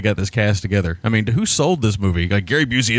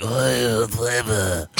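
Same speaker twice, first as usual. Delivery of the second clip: super gravelly Russian; 3 dB quieter, much slower than usual. Retold or imitated. Mimicking a Russian major for the co-hosts, super gravelly. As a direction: imitate a worn-out, gravelly Russian voice. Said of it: "his voice going to super gravelly".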